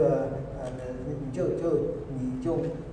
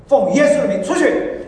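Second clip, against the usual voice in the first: slow, loud, high command to the demon; commanding shout; 9 semitones higher, 11 dB louder, slower than usual. Retold or imitated. Elders loudly casting out the demon. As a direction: order the spirit out, booming.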